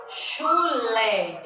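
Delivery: chu-lé in two firm, drawn-out beats; split into syllables. Shown as a demonstration. Teacher repeating chulé for pupils, syllable by syllable. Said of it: "Eu abro a boca duas vezes pra pronunciar".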